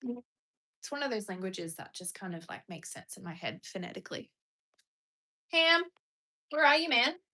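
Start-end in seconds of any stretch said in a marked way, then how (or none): 5.5-7.2 high, loud searching call; real raised-voice summons. Real, not acted. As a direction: call for a missing pet. Searching and calling for pet.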